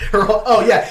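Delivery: laughing high and loud. Real, not acted. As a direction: burst out laughing at the phrase. Repeats phrase, bursts out laughing.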